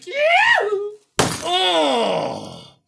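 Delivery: high, loud triumphant yell; excited whoop. Real, not acted. Host chopping the mystery cards, excited whoop. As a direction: whoop as the axe comes down.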